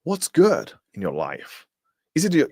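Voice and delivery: ordinary talk, nothing marked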